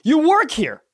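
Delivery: loud, high, slowed exasperated telling-off; exasperated scolding. Retold or imitated. Telling off the annoyed clerk in the golf shirt, loud exasperation.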